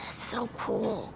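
hushed, breathy whisper. Acted. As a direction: whisper it in awe. Whispered reaction to gym badge.

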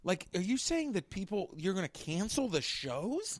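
high, drawn-out disbelief; incredulous protest. Retold or imitated. Comic retelling his reaction to canceled shows, incredulous.

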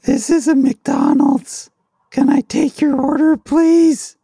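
high, loud singsong McDonald's server voice; put-on high voice, held notes, taking an order; mock drive-thru order taker. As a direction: call out the greeting like a hammed-up fast-food cashier. Mock fast-food order taker bit.

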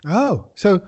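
usual speaking voice

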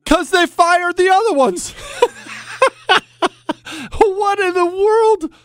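high, incredulous cackle through the words; real helpless laughter, pitch way up; laughing in disbelief. Real, not acted. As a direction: crack up at the absurdity. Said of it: "I mean, I'm laughing".

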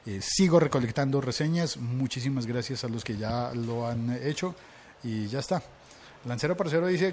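normal talking voice

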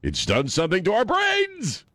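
ordinary delivery

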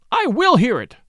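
loud, high, insistent demand; fierce outcry. A narrator's reading. Rachel demanding to hear the scandal, fierce loud cry. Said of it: "she cried out fiercely, at the top of her voice".

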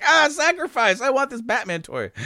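put-on high, laughing kid voice; hammed-up noble sacrifice for a toy; mock noble kid. Kid giving up his hair for a Batman toy, high and laughing.

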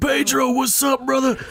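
high, loud, booming buddy greeting; Eddie Kingston greeting. Retold or imitated. Imitating Eddie greeting everyone at the door, high and loud. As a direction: call out hey brother like a big friendly wrestler. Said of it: "and he's so loud".

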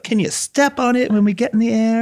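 high-pitched mock British diva; fake British accent. Imitating a diva hurrying the captain, fake British accent. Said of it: "in her fake British accent".

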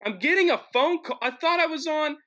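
high, loud startled outburst; real dismay. Real, not acted. Host interrupted by phone call mid-show, startled dismay. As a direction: blurt it out in high-pitched disbelief.

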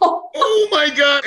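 high, slow, crying-out gasp of shock; stretched, high "Oh my God" of disbelief; class gasping. Retold or imitated. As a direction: draw it out high like a whole room gasping. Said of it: "Everybody in the class was like".

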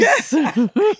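loud high laugh; high burst of laughter. Real, not acted. Laughs at her own line.

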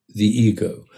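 ordinary talk

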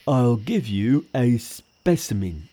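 a grumbling pig spirit, drawn out slowly; Pigsy. Acted.

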